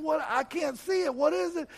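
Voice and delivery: high, pressing questions in rising excitement; urgent, bewildered. Retold or imitated. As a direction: ask desperately what he sees. Son urgently asks about vision.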